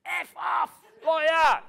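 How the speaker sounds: mock shout. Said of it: "like I'd shouted".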